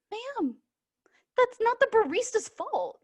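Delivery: high, careful customer-facing protest; mock polite objection. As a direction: stand up for a coworker in a high service voice. Acted reply to angry customer.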